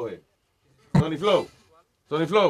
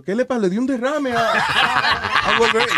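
laughing disbelief; 8 semitones higher than usual. Retold or imitated. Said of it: "y yo, yo".

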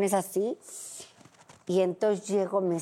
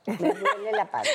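"Me duele la panza" in a much higher, whining plea; whiny complaint; 12 semitones higher, faster than usual. Shown as a demonstration. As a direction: whimper it like a needy wife calling her husband. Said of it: "aunque sea para decir". (queja quejumbrosa y mucho más aguda)

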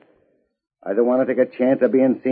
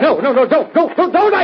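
panicked; 11 semitones higher than usual. Acted. Threatened with shot at dynamite.

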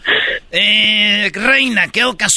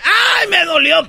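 fake crying child; 9 semitones higher than usual. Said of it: "Y yo lloraba y le decía".

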